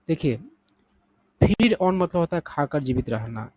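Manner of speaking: usual speaking voice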